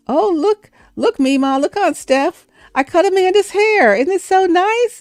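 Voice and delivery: put-on high, proud little girl voice showing off; a small child beaming over her handiwork, pitched high. Niece showing aunts the doll's haircut, high proud little girl.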